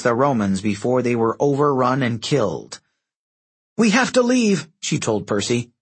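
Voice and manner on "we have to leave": urgent. A narrator's reading. Annabeth urges Percy to flee battle.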